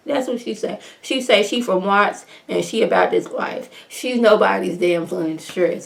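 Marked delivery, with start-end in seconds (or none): none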